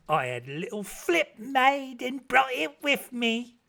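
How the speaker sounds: high, excited matronly voice, slowing on the ends; bustling good woman. Acted. Mrs Chickenstalker arriving to wish Meg joy, high and gushing. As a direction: gush over the bride with New Year cheer.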